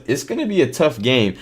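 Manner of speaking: ordinary talk, nothing marked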